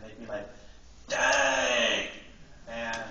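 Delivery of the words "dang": loud, drawn-out 'dang' at usual pitch; mock intense exclamation. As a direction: imitate kids reacting with one long loud exclamation. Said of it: "when something, like, really intense would happen".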